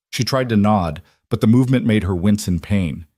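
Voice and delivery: ordinary delivery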